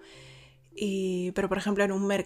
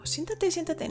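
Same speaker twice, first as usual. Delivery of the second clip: high, soft urging; insisting host; 9 semitones higher, 3 dB quieter than usual. Retold or imitated. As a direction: urge them to sit, higher. Anfitrión ofrece silla, agudo. (anfitrión insiste, agudo)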